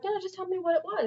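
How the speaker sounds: high-pitched imitated coaxing; retold coaxing. Retold coaxing of reluctant client.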